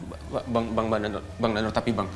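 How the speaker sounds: soft, low, faltering plea; fearful stammer. Acted. Younger brother shocked at being thrown out, stammering. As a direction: falter as you try to object.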